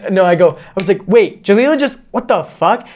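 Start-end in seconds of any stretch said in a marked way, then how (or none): 1.1-2.9 higher-pitched, baffled disbelief; acting out bewildered shock; retold freak-out. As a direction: replay a moment of stunned confusion. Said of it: "I was literally geeking out".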